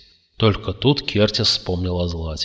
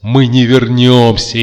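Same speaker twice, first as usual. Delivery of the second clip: louder, slow hysterical cry; 4 semitones higher, 10 dB louder, slower than usual. A narrator's reading. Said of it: "истерически захохотал Кертис". (истерический выкрик)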